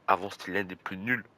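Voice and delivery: usual speaking voice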